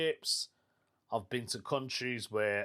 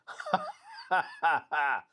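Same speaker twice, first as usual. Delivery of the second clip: hearty run of laughter; 4 semitones higher than usual. Real, not acted. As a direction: burst out laughing at your own joke. Laughs at own remark.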